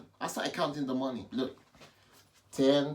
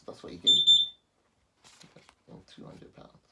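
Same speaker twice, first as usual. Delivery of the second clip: whisper; 8 dB louder than usual. Real, not acted. Amazed at the sum.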